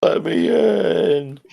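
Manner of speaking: high, drawn-out plea; softened echo of a desperate cry; mock plaintive wail. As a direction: stretch it into a slow pitiful whine. Imitates locked-out Louis again.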